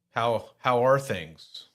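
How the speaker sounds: ordinary talk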